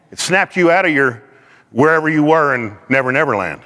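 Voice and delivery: usual speaking voice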